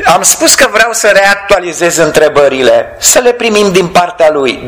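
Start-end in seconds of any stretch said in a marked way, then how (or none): none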